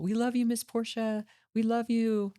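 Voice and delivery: high, excited farewell call from the inmates; girls calling out. Retold or imitated. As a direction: call it out like the girls running after the car.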